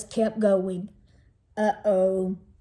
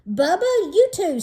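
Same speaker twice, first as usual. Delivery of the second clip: higher, sharp scold; snapping; 8 semitones higher, 4 dB louder than usual. Acted. Mother snapping at little brother at dinner, sharply. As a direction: cut him off with a snap. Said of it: "snapped my mother".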